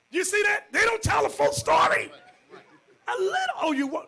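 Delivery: very high and loud, cracking up; laugh-yell the point; preacher laughing at the untold story; laughing shout. Real, not acted.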